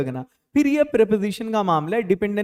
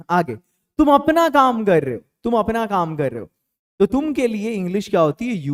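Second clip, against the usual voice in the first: ordinary talk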